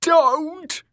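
very high, loud terrified shriek. A narrator's reading. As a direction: scream for mercy. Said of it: "shrieked Dick".

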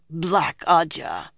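slow, reluctant naming; distasteful. A narrator's reading. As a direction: drag out the hated name. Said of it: "mouth still twisted around those words".

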